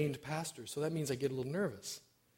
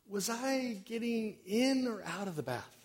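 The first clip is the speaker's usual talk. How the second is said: mock yell downstairs; 7 semitones higher, slower than usual. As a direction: slowly call down as a muddled old sister. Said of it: "she yells down the stairs".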